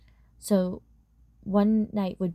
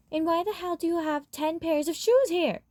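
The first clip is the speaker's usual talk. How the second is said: much higher, disbelieving complaint; exasperated dad; 10 semitones higher than usual. Acted. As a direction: push it up high in irritated disbelief. Dad's exasperated question about the shoes.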